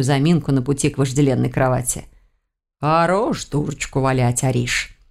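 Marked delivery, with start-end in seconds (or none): 2.8-5.0 slower, slightly low cat voice; scolding cat. A narrator's reading. Cat tells the witch to stop fooling, drawling reproof. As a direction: lazily tell her off, dropping low at the end. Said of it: "отозвался кот".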